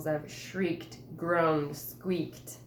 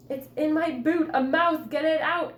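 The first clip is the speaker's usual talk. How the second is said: panicked plea; 9 semitones higher, 10 dB louder than usual. Acted. Mouse in boot, panicking.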